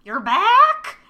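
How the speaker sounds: put-on high, loud, quavering old woman's call. Great grandma calls from the window, high and loud. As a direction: call down from the window like a confused ancient granny. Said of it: "Great Grandma looks out the window and goes".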